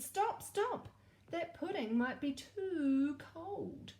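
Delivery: the prince stops the king just in time; high shouted alarm; prince shouting. Acted. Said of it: "but the prince shouted".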